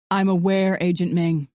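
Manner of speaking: flat, expressionless reproach. A narrator's reading. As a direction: say it dead flat, no feeling. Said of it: "his voice as flat as his expression".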